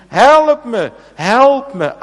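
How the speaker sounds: high, loud cry of a drowning swimmer; desperate cry for help. Pretend. Preacher voicing a swimmer caught in a strong current, desperate high cry. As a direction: call out as if swept away by the current.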